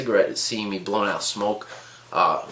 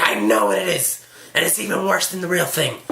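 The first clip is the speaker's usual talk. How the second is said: put-on indignant old woman, rising higher; angry old lady; 7 semitones higher than usual. Old lady interrupting his polite explanation, mock indignant retort. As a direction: imitate a scolding old lady. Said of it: "she turned from this quaint ... to just the beast".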